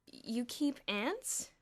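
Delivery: slow incredulous question; disbelief. A narrator's reading. Bruno asking his roommate in disbelief, slow. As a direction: drag it out, can't believe it. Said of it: "in disbelief".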